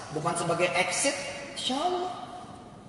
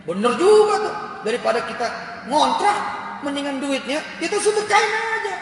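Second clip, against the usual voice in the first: much higher and louder, carried away with enthusiasm; eager excited take; 8 semitones higher, 6 dB louder than usual. Shown as a demonstration. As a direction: blurt it out like a man sold on a crazy idea. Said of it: "Omongannya lain, Pak".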